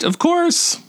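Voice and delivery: very high squeaked reply. Retold or imitated. Student asked back next week, squeaking her answer. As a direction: squeak it out, pitch shooting up. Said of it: "I squeaked".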